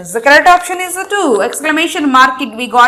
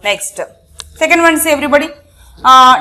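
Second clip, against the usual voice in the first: ordinary delivery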